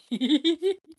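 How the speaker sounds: high, loud giggle; quick burst of high giggling; amused laugh. Real, not acted. Streamer tickled by the fifty-seven minutes, high loud giggle.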